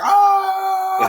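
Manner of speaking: very high, loud falsetto shriek. Retold or imitated. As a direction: let rip the signature yelp. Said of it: "he did the scream".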